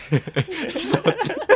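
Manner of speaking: high, breaking into laughter; laughing. Real, not acted. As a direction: laugh it off in surprise. Sudden awkward question, laughing. (照れて大笑いしながら)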